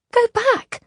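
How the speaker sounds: high, loud cry to turn back; shouted plea, pitched high; urgent shout. A narrator's reading. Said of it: "Isolde shouted".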